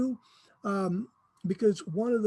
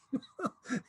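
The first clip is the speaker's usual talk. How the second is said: short laugh; 4 semitones higher, 6 dB quieter than usual. Real, not acted. Laughs at his own mock excuse.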